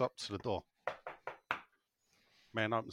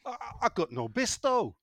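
higher-pitched comic Yorkshire housewife; put-on Yorkshire wife voice, higher; 7 semitones higher than usual. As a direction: call to your husband as a Yorkshire wife in a joke. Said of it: "the wife comes out and says to her husband".

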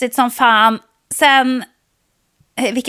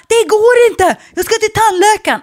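much higher, louder desperate cry; panicked shout; 11 semitones higher, 5 dB louder than usual. Retold or imitated. To the shrugging garbage man, panicked high shout. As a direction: shout it like you're about to lose it. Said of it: "höll på att få panik".

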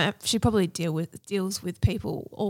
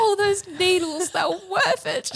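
mock joyful quote; 9 semitones higher, slower than usual. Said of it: "You're like".

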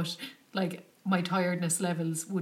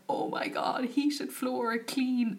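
high breathy awe at the floor; gushing in disbelief, pitched up; delighted gush; 7 semitones higher, much slower than usual. Retold or imitated. Lying on heated floor.

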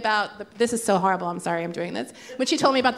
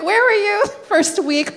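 incredulous; 10 semitones higher, 4 dB louder than usual. Retold or imitated. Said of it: "And I was just like".

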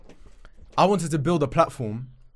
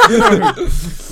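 high, slow teasing laugh; 10 dB louder, much slower than usual. Real, not acted. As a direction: drag it out while laughing. Teasing hint at Faisal.

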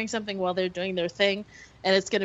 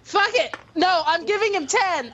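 emphatic decision; 7 semitones higher than usual. Acted. Player abandoning careful haggling over the stable fee, emphatic. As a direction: throw caution away with a raised voice.

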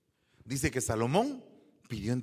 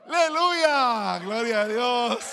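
loud, high, slow hallelujah; exultant praise shout; 10 semitones higher, 11 dB louder, slower than usual. Real, not acted. Preacher shouting praise to the congregation.

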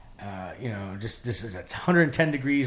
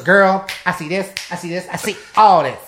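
loud, higher, stretched-out sass; sassy companion voice; 5 semitones higher, 7 dB louder than usual. Retold or imitated. Transgender companion reacts to the scene, loud, high and sassy. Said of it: "then the trainer goes".

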